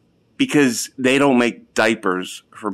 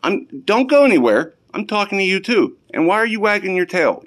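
raised voice, telling off the dogs; scolding yell; 7 semitones higher than usual. Real, not acted. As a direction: yell at the dog. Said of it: "You're getting yelled at".

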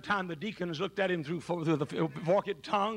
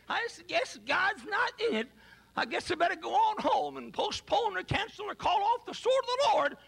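higher, hammed-up whiny quitter; mock quitting preacher; 13 semitones higher than usual. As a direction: play the defeated quitter, half laughing. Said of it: "Doctor John Rice didn't say".

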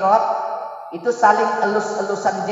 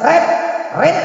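calling husband; 7 semitones higher than usual. Retold or imitated. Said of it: "Coba kita praktekkan di sini".

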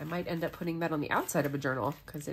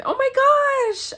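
1 excited gasp; 12 dB louder, much slower than usual. Real, not acted. Opening pen pal gift.

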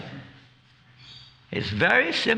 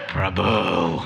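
low, drawn-out warning; wrong-mood address; 6 semitones lower, 3 dB louder than usual. Pretend. Devotee warning another devotee, low mock menace. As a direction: say the title as a low menacing reproach. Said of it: "not always with the right mood".

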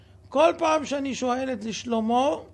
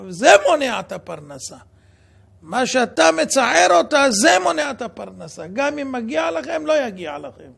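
much louder, stern reproach; loud rebuke; 8 dB louder than usual. Retold or imitated. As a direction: hammer the point home to the husband. Rabbi rebukes husband on phone. (תוכחה בקול רם)

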